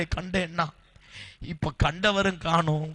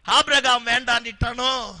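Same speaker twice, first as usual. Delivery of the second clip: loud, higher, building preacher's cry; fervent preaching; 6 semitones higher, 7 dB louder than usual. Acted. Preacher as God questions Hagar's despair, loud building cry. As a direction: preach it with rising fire.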